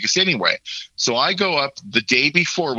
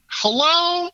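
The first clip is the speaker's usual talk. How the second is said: very high-pitched, drawn-out "hello"; mock-incredulous, sing-out retort. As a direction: play an obvious "duh", pitched way up. Acted sarcastic reply to mother.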